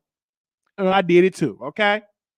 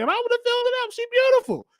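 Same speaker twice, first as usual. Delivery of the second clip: very high, excited exclamation; high-pitched giddy hype; excited squeal; faster than usual. Real, not acted. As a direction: squeal about how good she looked. Excited about beautiful woman.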